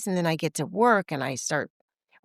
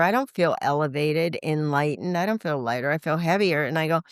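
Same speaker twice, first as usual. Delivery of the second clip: ordinary talk, nothing marked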